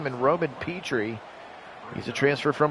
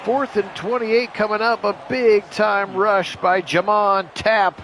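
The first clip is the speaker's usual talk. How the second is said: higher, louder, slow stretched hype of the rush; excited call; 7 semitones higher, 5 dB louder, slower than usual. Acted. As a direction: sell the big rush. Hyping the big rush.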